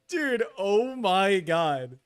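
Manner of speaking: ordinary talk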